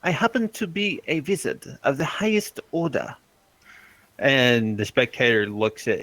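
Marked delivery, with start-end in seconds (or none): 0.0-3.2 pompous wizard. Acted.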